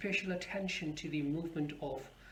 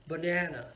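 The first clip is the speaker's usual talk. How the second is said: "banana" as ba-NA-na, falling at the end; stress on second syllable. Shown as a demonstration. Teacher repeats it so learners hear the stress, middle stressed. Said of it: "it's on the second syllable".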